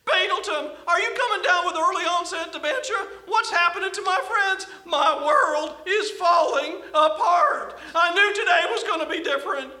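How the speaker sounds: high, frantic newcomer; panicked slug voice. Acted. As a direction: cry out in panic.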